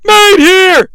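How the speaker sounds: high, loud, dramatic announcer proclamation; wrestling announcer. Retold or imitated. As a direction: imitate a ringside announcer at full pitch. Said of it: "He then corrects himself".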